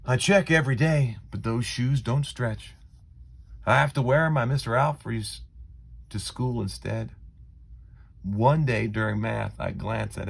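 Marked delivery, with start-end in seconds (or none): none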